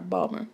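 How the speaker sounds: "Balmer" as bawl-mer; "Balmer" said like bawl plus mer. Shown as a demonstration. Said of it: "as if it were spelled B A W L M E R".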